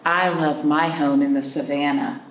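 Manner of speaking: ordinary delivery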